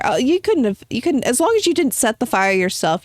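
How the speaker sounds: usual speaking voice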